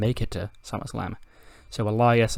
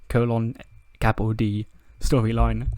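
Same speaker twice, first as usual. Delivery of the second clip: normal talking voice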